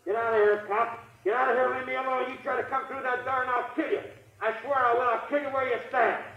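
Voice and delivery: high, strained threats from behind a door; desperate, cornered threat; defiant threat. Acted. The armed suspect threatens the cops from behind the door, high and strained.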